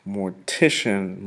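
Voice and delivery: Mortician said slowly and clearly; slow model word. Shown as a demonstration. Teacher slowly modelling Mortician for the class. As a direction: spell out Mortician by sound, slowly. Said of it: "An undertaker is a mortician".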